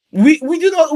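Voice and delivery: ordinary delivery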